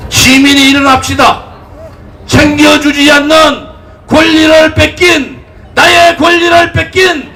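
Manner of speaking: impassioned political rally speech; roaring rallying speech. Acted. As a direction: rouse the crowd at full force.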